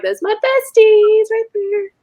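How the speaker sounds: high, drawn-out fangirl squeal; hammed-up adoration, stretched out; gushing. Mom seeing the hosts on TV, gushing.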